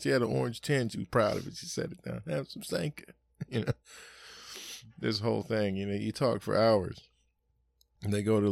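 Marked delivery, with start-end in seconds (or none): 2.3-3.1 mimicked mother. Proud mother serving.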